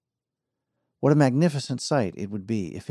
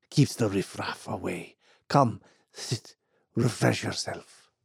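put-on old man voice.

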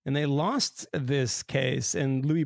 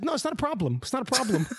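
put-on reassuring old woman; 10 semitones higher, faster than usual. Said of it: "and she's like".

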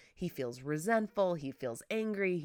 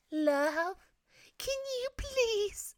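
much higher, breathy little voice; cutesy pleading ask; 3 dB louder, much slower than usual. Shown as a demonstration. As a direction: beg sweetly, almost whispering. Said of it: "everything that comes out of my mouth is like".

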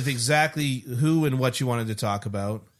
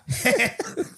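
mobster laugh; 9 semitones higher than usual. Retold or imitated. Paulie laugh after own joke.

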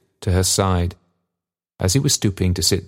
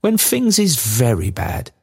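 put-on earnest old father; 6 semitones higher, slower than usual. A narrator's reading.

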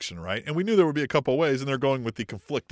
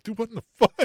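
high, loud mock bewilderment; imitating stunned outrage; Brock Lesnar, shocked; 9 semitones higher, 6 dB louder, faster than usual. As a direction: blurt it high in disbelief. Voicing Brock's shocked reaction.

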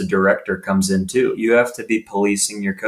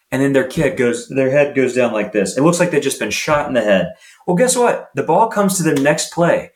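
ordinary talk, nothing marked

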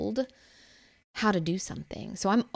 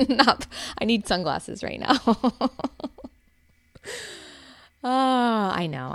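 laughing; 7 semitones higher, 4 dB louder than usual. Real, not acted. Joking to viewers about needing sunglasses, laughing. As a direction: joke through real laughter. Laughing at her own cheesiness.

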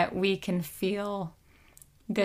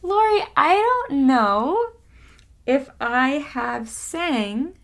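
excited; 5 semitones higher, 7 dB louder than usual. Real, not acted. Just finished singing exercise.

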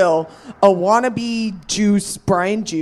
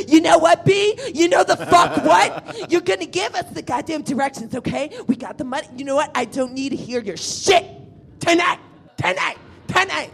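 high, scolding ex-con mother; tough New York mom; 8 semitones higher than usual. Retold or imitated. Casey arguing with a grown man, high and scolding. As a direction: lay down the law like a jailhouse mom. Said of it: "sounded like she was a mother from New York who got into prison".

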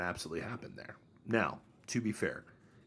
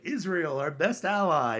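mock enthusiasm; 7 semitones higher, 4 dB louder, slower than usual. Meme's headline praising Israel.

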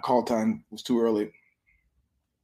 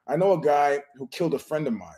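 ordinary talk

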